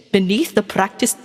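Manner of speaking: ordinary delivery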